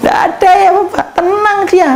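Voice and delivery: sing-song high reply to the congregation; high playful answer. Acted. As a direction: lift the voice high and light, teasing. Preacher voicing calm baby, high. (nada tinggi bercanda)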